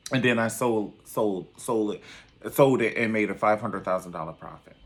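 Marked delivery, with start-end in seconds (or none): none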